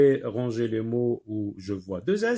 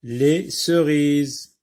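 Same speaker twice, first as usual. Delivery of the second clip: "les cerises" drawn out very slowly; very slow dictation; 5 dB louder, much slower than usual. Shown as a demonstration. Teacher dictating "les cerises" very slowly. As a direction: stretch "les cerises" for writing. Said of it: "Qu'est-ce qu'on a dit de Dina?".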